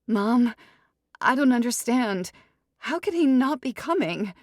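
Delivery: high, loud, rushing worried chatter; self-absorbed bride spiralling out loud; panicky bride. Acted. Ruby's bride voice.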